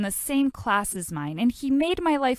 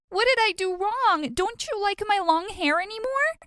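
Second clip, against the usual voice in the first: screaming; 10 semitones higher than usual. A narrator's reading. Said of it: "I barged into the room screaming".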